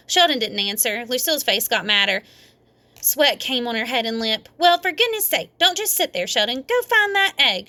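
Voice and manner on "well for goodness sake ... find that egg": high, mock-snippy order; snapped. A narrator's reading. Lucille snapping at Sheldon to go find the egg, mildly snippy. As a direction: snap at him like a princess. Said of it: "she snapped".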